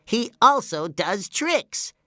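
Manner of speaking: high, slow, eager cartoon voice; high character voice, drawn out for effect; SpongeBob. A narrator's reading. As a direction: announce it proudly in a high cartoon voice. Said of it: "he explained to Patrick".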